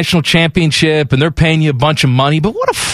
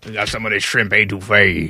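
low, soft hammed-up Southern accent; mock Southern accent; 9 semitones lower, 5 dB quieter than usual. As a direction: mimic the coach's phony Southern accent. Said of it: "a fake Southern accent".

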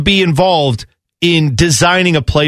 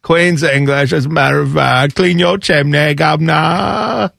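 mock cockney chimney sweep. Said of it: "Was that English?".

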